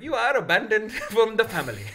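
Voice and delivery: put-on high, loud Indian accent breaking into a laugh; Indian relatives' accent. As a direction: imitate a disapproving Indian uncle. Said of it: "how my uncles and aunties talk".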